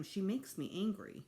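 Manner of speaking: ordinary delivery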